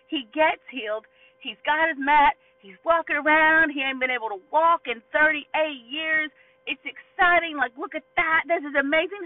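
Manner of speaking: loud, high, mounting excitement; excited. Acted. Preacher celebrating a healed man now walking, excited. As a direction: marvel at it at full volume.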